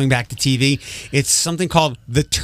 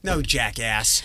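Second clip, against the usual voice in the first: slow, softer mock put-down; unimpressed retort, drawn out; retold deadpan comeback; 3 semitones lower, 6 dB quieter, slower than usual. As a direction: drop the insult slowly and drily. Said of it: "I'm like".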